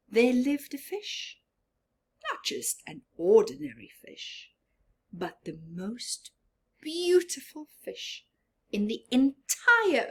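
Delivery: lively read-aloud to a class; storybook narration. A narrator's reading. Teacher reading to her class, lively storybook voice. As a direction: read it like a teacher with picture book.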